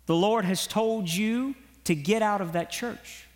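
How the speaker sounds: ordinary talk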